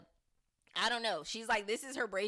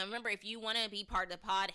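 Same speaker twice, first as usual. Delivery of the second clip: ordinary delivery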